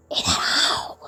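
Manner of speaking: whispered hush and beckon; secret whisper. Acted. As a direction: lure her close in a whisper. (फुसफुसाकर पास बुलाना)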